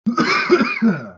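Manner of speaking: harsh hacking cough; coughing fit. Real, not acted. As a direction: cough hard from a cold. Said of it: "I'm having a little bit of a coughing fit".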